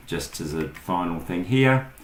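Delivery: usual speaking voice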